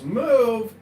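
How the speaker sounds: reenacted yell. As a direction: call it out sharply, short of a full scream. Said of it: "So he yells".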